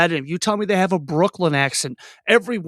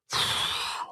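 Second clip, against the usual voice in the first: long, soft blown-out breath; long sigh; 10 dB quieter than usual. Acted. Players sitting out a long scene, bored sigh. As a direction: let out a bored, waiting sigh.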